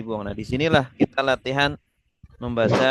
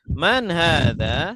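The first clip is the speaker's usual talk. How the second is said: Man hadza said slowly, rising like a question; slow it down for them to repeat; slow model phrase; 3 semitones higher, much slower than usual. Shown as a demonstration. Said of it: "Ini sebelah kanan ya". (Man hadza diucapkan pelan untuk ditirukan)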